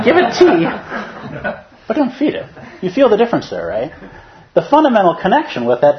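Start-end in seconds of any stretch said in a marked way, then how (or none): 0.0-2.5 high, loud mock of the teacher with a laugh; a hammed-up nod to the teacher; teacher's voice. Student quoting his teacher with a grin, high and loud. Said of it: "He said that over there, that guy".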